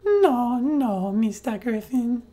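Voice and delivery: put-on high, slow, deliberately terrible Mexican accent; bad Mexican accent. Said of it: "I would have done that dialogue in a Mexican accent".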